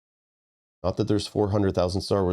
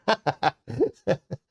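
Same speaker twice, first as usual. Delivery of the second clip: laugh; 8 semitones higher than usual. Real, not acted. Laughs at a chat joke.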